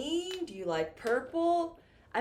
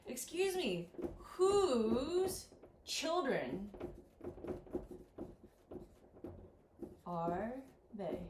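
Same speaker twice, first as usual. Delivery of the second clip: drawn-out, slow polite question; 6 dB quieter, slower than usual. Shown as a demonstration. Teacher asking strangers in the park, slow and polite. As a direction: stretch each word slowly, politely asking.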